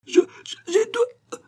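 very high, slow choking stammer; death rattle. A narrator's reading. Old locksmith dying before Malouin, choking rattle. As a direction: gasp out the last broken words. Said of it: "râla le vieux".

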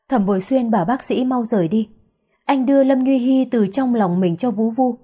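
usual speaking voice

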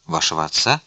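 ordinary delivery

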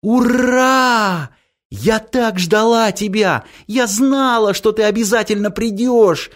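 high, squealing with joy; excited little girl. Acted. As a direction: shriek it like a thrilled child. Girl overjoyed at Grandfather Frost.

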